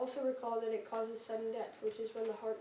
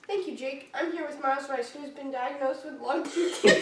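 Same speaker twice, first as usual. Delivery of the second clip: louder intro fighting back laughter; barely holding in a laugh; 3 semitones higher, 8 dB louder than usual. Real, not acted. Retake, laughing.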